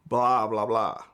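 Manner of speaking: normal talking voice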